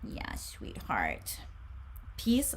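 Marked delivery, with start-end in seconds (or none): 0.1-1.4 low, slow soft whisper to the pet; tender to pet. Real, not acted. Reader murmurs tenderly to her pet in a low whisper. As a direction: soothe the pet softly.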